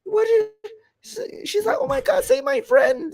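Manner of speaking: high, loud, mock-crying plea; panicked pleading girl. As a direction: beg him frantically to save her friend. Girl begging the hero, crying.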